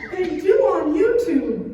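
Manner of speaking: put-on slow, loud, laughing child insistence; insistent kid. Son insisting to mom about YouTube, loud and slow. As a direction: drag out each word like a stubborn boy.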